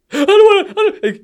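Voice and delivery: a meltdown at the trash can; imitating a wailing kid. Dad mimics his son freaking out over the toy, wailing kid voice.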